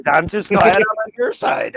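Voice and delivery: emphatic shout. Real, not acted. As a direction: shout it out with relief. Emphatic repeat of gladness.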